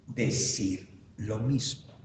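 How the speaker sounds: slow, low, soft answer; slow, deliberate. Real, not acted. Teacher answering own question for the class, slow and deliberate. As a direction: give the answer slowly and softly.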